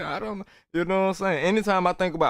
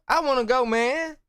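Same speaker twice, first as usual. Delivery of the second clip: excited; 10 semitones higher, 3 dB louder than usual. Real, not acted. Eager to join the program.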